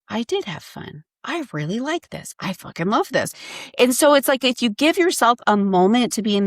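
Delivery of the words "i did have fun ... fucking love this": quiet, hushed joy; soft delighted admission. Retold or imitated. Answering her boyfriend after surfing, quiet delight. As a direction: murmur it to yourself in delight. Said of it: "I find myself going".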